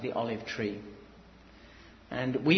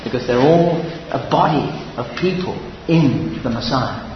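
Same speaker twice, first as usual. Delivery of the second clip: loud, driving sermon delivery; full-force preaching; 11 dB louder than usual. Acted. Preacher to congregation, loud fervent preaching. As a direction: proclaim it to the whole hall.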